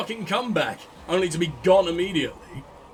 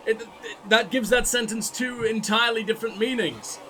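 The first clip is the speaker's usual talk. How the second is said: ordinary delivery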